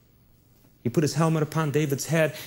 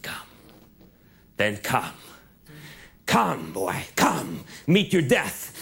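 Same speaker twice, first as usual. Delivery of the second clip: low, slow taunting giant; Goliath, taunting; 3 semitones lower, slower than usual. Acted. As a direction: drag it out, menacing.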